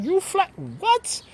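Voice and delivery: incredulous high cry; shocked disbelief. Retold or imitated. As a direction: act floored by what she said. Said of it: "I'm looking at her like".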